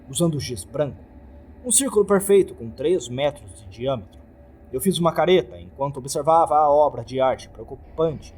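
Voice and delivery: ordinary delivery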